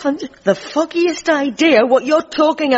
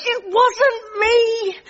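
high, drawn-out cry of protest; exasperated denial; 6 semitones higher, much slower than usual. Acted. Denying blame in a quarrel.

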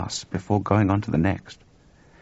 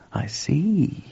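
hushed, drawn-out acknowledgement; slow, thoughtful; 9 semitones higher than usual. A narrator's reading. George takes in Chuck's warning, slowly. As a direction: let it sink in slowly. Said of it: "said George slowly".

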